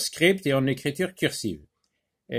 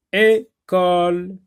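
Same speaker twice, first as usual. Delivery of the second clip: syllable by syllable; 3 semitones higher, 5 dB louder, slower than usual. Shown as a demonstration. Teacher models words slowly.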